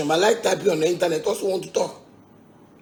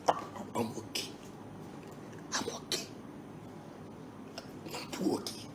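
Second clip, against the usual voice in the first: whispered fade; 6 semitones lower, 18 dB quieter than usual. Real, not acted. Fading repeat of catchphrase.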